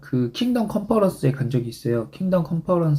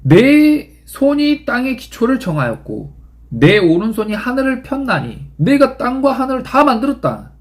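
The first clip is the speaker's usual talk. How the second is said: a lofty voice of God, slowed and raised; 7 semitones higher than usual. Acted. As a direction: declare it like the Creator speaking. Pastor voices God declaring creation.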